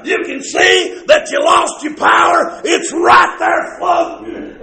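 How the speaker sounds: fervent preaching at the top of the register; full-force preaching. Real, not acted. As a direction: build to a loud, high pulpit cry.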